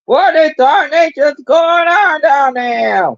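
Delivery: a hammed-up Southern accent, stretched out. Sibling asks for the Southern line, slow mock Southern accent. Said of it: "same Southern accent".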